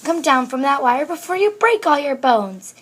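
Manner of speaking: fretful mama calling out; worried wail. A narrator's reading. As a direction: plead urgently up to the child. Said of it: "wailed Mama Junebug Jones".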